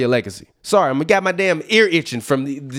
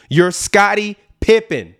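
normal talking voice

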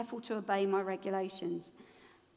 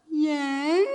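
reluctant yes; 6 dB louder than usual. Retold or imitated. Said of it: "hearing himself go".

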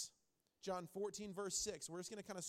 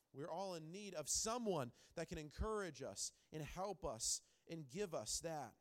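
ordinary talk, nothing marked

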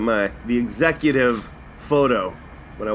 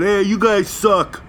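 slow, sneering mock of the filmer; mocking quote; 8 semitones higher, 4 dB louder, slower than usual. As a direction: drag it out like a smug whiner. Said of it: "film the employees saying".

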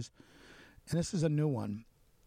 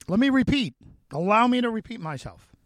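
raised voice, much higher and louder; emphatic announcement; 10 semitones higher, 11 dB louder than usual. Real, not acted. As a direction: call it out forcefully to the listeners. Host emphatically flags a repeat.

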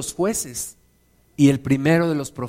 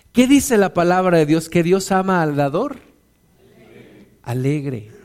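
usual speaking voice

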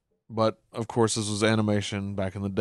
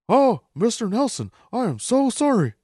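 much higher, gushing Hank; fanboy apology; 12 semitones higher, 3 dB louder than usual. Retold or imitated. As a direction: starstruck fan apologizing. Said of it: "concerned slash fangirl moment".

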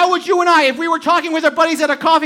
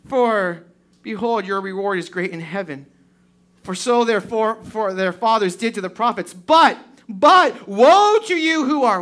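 scripture reading; 3 semitones lower than usual. Acted. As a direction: read each blessing out deliberately. Reads the Beatitudes aloud.

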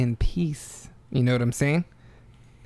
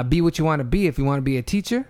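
upbeat show sign-off, pitched high; big host farewell line; sign-off outro; 6 semitones higher, faster than usual. Pretend. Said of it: "That's your new outro?".

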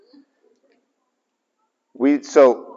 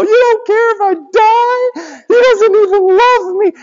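very high, slow whiny wail; mock us whining like a sulky kid to God; whiny child; 3 dB louder than usual. Us whining to God like a child, very high and slow. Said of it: "And that's us with God".

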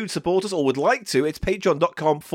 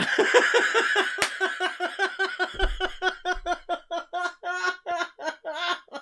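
much higher, breathless giggling fit; long helpless laugh; 12 semitones higher, 6 dB quieter than usual. Real, not acted. Laughing after the cat sketch, helpless giggling. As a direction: crack up completely.

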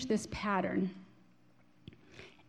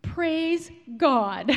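slow skywriting words; 5 semitones higher, 5 dB louder than usual. Acted. Reading the skywriter's last message.

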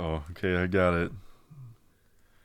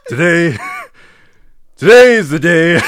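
loud, raised declaration in an amateur impression; Tim Allen; 5 semitones higher, 9 dB louder than usual. Friend reciting his assigned quote, loud impression. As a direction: proclaim it like a sitcom star. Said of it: "sounded like Tim Allen".

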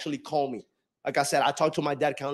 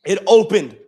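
sudden loud declaration; 10 dB louder than usual. Real, not acted. Repeated with sudden force.

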